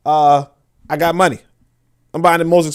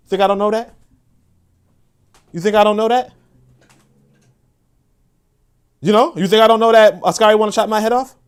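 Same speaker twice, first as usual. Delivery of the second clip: rising defiant challenge; 6 semitones higher, 8 dB louder than usual. Real, not acted.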